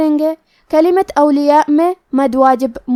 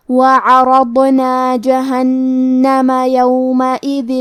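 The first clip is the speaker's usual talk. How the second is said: slow, measured tajweed recitation; slow chanted Quranic recitation; much slower than usual. Acted. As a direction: recite the verse slowly and melodically. Teacher recites verse for tajweed lesson.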